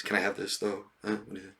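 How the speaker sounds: freeloading friend asking for pricey items, soft wheedle; sly quiet request. Retold or imitated. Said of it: "More items, more things".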